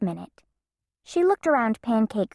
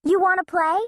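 calling out; 9 semitones higher, 3 dB louder, faster than usual. Acted. Calling to Katie.

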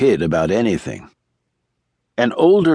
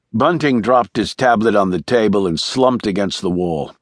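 usual speaking voice

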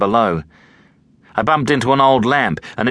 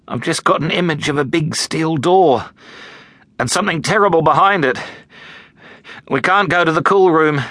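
frightened; 7 semitones higher than usual. A narrator's reading. Terrified boy warns of death.

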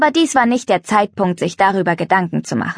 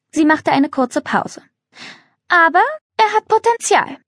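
lightly voiced haughty aristocrat, pitched higher; snooty countess; 5 semitones higher than usual. A narrator's reading. Contessa judges the farm with lightly voiced disdain. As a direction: sniff at the shabby farm.

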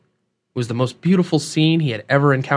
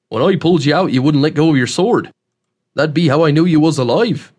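emphatic country-dialect character voice; folksy backwoods host with a strong twang; rustic old man, plain-spoken; 3 semitones higher, 4 dB louder than usual. Acted. Host holding up the rescued man's sword, rustic voice.

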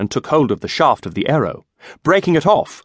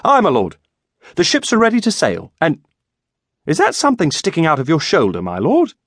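put-on higher-pitched, eager young servant; raised, lively squire voice; chattering squire; 5 semitones higher than usual. A narrator's reading. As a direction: babble on to your master without waiting.

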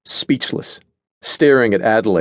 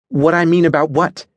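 higher-pitched, rising, mildly agitated question; 8 semitones higher, 3 dB louder than usual. A narrator's reading. Natasha asks Adelaide what she means, agitated. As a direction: demand an explanation with creeping agitation. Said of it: "agitation creeping into her voice".

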